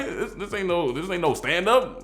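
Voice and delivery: indignant. Retold or imitated. Upset that friend laughs.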